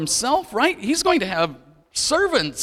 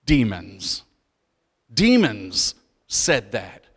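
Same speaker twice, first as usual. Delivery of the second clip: dramatic low reveal; 8 semitones lower, slower than usual. Acted. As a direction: drop the voice low and slow for Demons. Dramatic reveal of who spoke.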